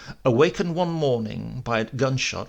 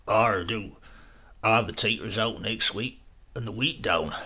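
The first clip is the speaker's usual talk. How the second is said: lightly voiced old rural countryman; rural country accent. Old farmer inviting narrator to help with harvest.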